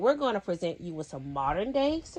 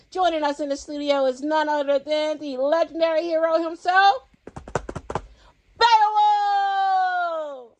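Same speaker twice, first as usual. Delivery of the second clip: rising, louder build to the name; hyped announcement; 9 semitones higher, 6 dB louder than usual. Acted. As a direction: announce the hero like a showman. Host announces legendary guest.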